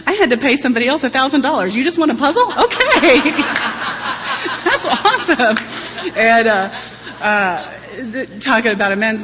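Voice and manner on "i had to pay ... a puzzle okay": high, loud words broken by laughter; laughing disbelief. Real, not acted. Mother laughing that her son only wants a puzzle. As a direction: laugh it out, delighted at the small ask.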